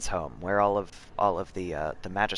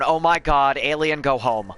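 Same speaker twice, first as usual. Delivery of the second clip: mock outrage; 5 semitones higher, 6 dB louder, slower than usual. Real, not acted. Groaning at a pun.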